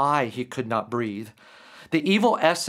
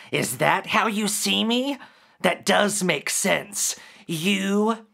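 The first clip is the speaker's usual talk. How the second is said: mocking creature; 5 semitones higher than usual. Creature speaks, laughing at Derek.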